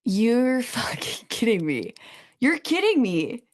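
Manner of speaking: slow hushed disbelief rising to a high-pitched repeat; stunned and disbelieving; incredulous. Real, not acted. Co-host reacts in disbelief to news.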